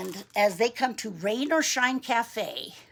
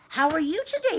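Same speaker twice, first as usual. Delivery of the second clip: higher-pitched greeting; friendly grocer; 7 semitones higher, 4 dB louder, faster than usual. Acted. Grocer greeting Mister Frog, friendly. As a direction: play a grocer greeting a child. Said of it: "fruit grocer knows him and even says".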